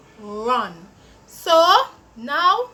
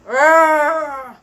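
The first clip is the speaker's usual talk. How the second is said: louder growling R like a monster; playful monster growl on R; scary R sound; 7 dB louder than usual. Acted. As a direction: growl the R to frighten the kids. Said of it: "It makes the scary sound".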